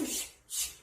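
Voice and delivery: voiceless whoosh, twice; short soft rushes of air; imitated passing-car whoosh. Consultant showing how a running child sees things, whispered whooshes. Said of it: "차 타고 지나가는 것처럼".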